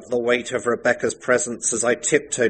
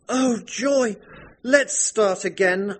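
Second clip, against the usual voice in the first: put-on much higher girlish plea with a sob; pleading bride; 12 semitones higher, slower than usual. The bride begs her returning husband, high and crying. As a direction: beg like a desperate young bride. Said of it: "I pleaded".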